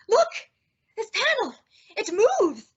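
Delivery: thrilled at the hidden panel; eager astonished exclamation; excited discovery. Acted.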